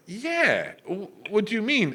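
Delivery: high-pitched confused reply; innocent bafflement. Retold or imitated. Retold innocent confusion at friends' shock.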